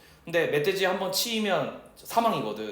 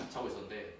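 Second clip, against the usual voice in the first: hushed suspense; 10 semitones lower, 14 dB quieter, faster than usual. Shown as a demonstration. Building suspense before snake.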